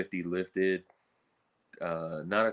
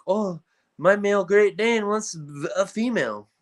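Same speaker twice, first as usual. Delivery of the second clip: hammed-up Spanish accent, higher and louder; a deliberately bad Spanish accent, pitched up; 11 semitones higher, 6 dB louder than usual. Breeder quoting an El Paso dog owner, bad Spanish accent. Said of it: "That's my worst Spanish accent".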